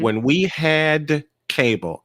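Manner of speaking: HAD drawn out and pitched high; stress on HAD. Real, not acted. Recalling the days when we had cable, stress on HAD. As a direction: lean slowly and hard on "had". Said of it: "capitalize and stress the word had".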